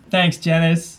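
ordinary talk, nothing marked

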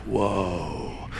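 low, soft imitated "whoa"; hushed mock amazement. Preacher imitates an actor hearing trivia, hushed mock amazement. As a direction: murmur a drawn-out mock-awed reaction. Acts out man's amazed reaction.

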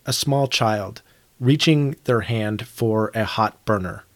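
ordinary talk, nothing marked

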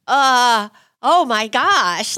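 high, loud gasp of surprise; oh my gosh, said slowly and starstruck; stunned. Retold or imitated. Reporter spots Holyfield on stairs, stunned gasp.

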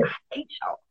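hissed whisper, playful. Pretend. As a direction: whisper it like a secret grudge. Mock hating union folks.

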